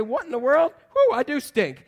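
high, loud mock astonishment; hammed-up baffled exclamation; comic bewilderment. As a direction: play a man startled by his own smell. Risen Lazarus reacting, acted comically.

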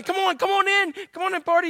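pleading father. Retold or imitated. The father pleads with his older son to come in, coaxing. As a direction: coax him gently to come in. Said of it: "pleaded with his son".

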